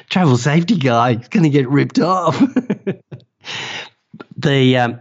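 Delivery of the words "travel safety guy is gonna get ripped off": self-mocking, through laughter, pitched up and louder; laughing at himself. Telling the host how he laughed at himself, laughing. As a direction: chuckle it out at your own bad luck.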